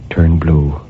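ordinary delivery

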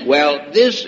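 ordinary talk, nothing marked

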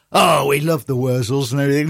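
imitated gushing Manchester accent; lightly done Mancunian accent; Manc accent. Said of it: "Manc accent".

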